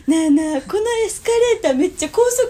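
関西弁で大声で呼びかける真似; ふざけて声を張り上げて; loud mock call. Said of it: "大声で言ってる". (大声でふざけて呼ぶ)

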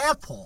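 "Apple" said as "epo", open e first; model English pronunciation. Shown as a demonstration. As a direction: show how "Apple" sounds, like "EH-po". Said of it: "エポや". (「Apple」を「エポ」と英語発音で手本)

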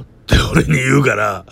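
normal talking voice

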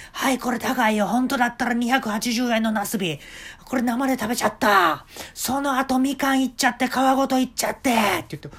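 high, loud eager boss voice; a showman giving orders; eccentric shop owner. Retold or imitated. Owner voiced in a retold story. (店の大将が客に猿になる手順を指示する、高く大きな声)

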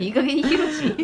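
giggling Gunma-style scold; laughing dialect imitation. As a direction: say it while laughing. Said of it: "誰？".

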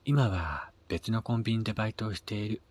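usual speaking voice

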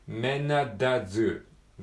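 "メナダズー" with the t dropped, all run together; linked phrase. Shown as a demonstration. Teacher models the linked "メナダズー" slowly. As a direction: glide through "メナダズー" without the t. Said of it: "アットザじゃなくて".

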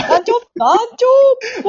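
cheering call. Pretend. Member cheering the troupe leader as a joke, loud and high. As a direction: chant his title like a fan in the crowd.

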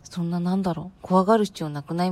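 usual speaking voice

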